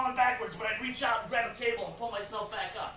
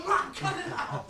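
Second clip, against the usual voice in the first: exasperated yell; 10 semitones higher, slower than usual. Acted. Son telling his mother off, yelling. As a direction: yell it slowly to shut her up. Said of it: "you don't have to yell at me".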